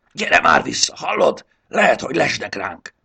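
high, loud whooping call; yelling. A narrator's reading. Triceps hollering after runaway Módusz, loud and urgent. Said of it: "kurjantott utána ismét".

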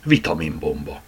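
ordinary delivery, nothing marked

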